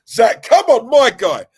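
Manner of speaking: high, loud excited greeting; big up the new viewer loudly; hyped shout-out. Real, not acted.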